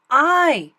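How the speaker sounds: loud, high shout of aye. A narrator's reading. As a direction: cast the vote with a big yell. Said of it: "shouted Thidwick".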